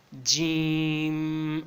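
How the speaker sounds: جیم very slow, ending in a held hum; drawn-out letter. Shown as a demonstration. Teacher showing the final m sound, long جیم. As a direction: stretch جیم, "jeeeemmm". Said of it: "آخر میں میم کی آواز آئے گی".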